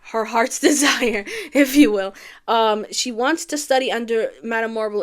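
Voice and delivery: ordinary talk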